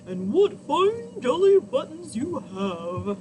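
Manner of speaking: loud, booming cow; hungry cow admiring its snack. A narrator's reading. Narrator voicing the cow to listening children. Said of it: "mooed the cow".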